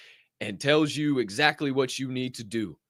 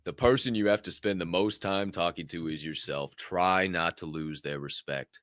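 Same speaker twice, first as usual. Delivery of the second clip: quiet, reflective; 9 semitones lower, 7 dB quieter than usual. Real, not acted. Host repeats quote softly.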